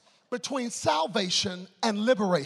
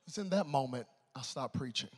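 usual speaking voice

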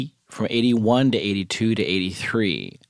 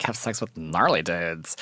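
a light, softer take on the friend's gushing voice; imitating his friend; 3 semitones lower, 4 dB quieter than usual. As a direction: mimic him lightly, dreamy and low-key. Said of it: "he always talked like this".

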